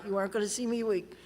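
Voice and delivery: ordinary talk, nothing marked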